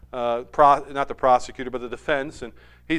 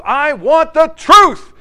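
slow, loud, higher demand for the truth; demanding shout; 7 semitones higher, 11 dB louder, slower than usual. Retold or imitated. Lawyer demanding the truth from the colonel, slow shout. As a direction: hammer out each word. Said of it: "And he goes".

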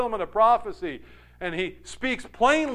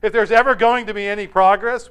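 raised-pitch mock political tirade; ranting on and on; 5 semitones higher, 6 dB louder than usual. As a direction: imitate a peasant lecturing the king. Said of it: "touting on and on".

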